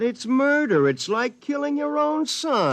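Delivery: high, desperate plea; begging for his life in a high voice; panicked high-pitched protest; frantic pleading. Acted. Pleading with gun-wielding double.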